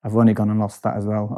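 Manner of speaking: usual speaking voice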